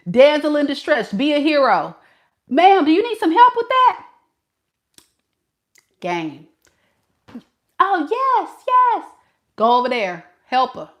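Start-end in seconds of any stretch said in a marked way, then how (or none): 2.5-4.1 higher-pitched, eager offer of help; gallant helper. Acted. Man offering a lady help in the store, gallant and high-pitched. Playing hero to damsel. 7.8-9.1 high, grateful mock-damsel voice; damsel in distress. Lady in the store accepting the hero's help, high damsel voice.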